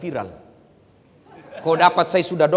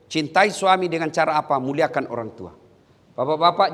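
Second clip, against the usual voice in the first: ordinary delivery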